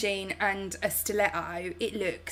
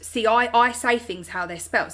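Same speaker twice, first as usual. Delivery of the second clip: ordinary delivery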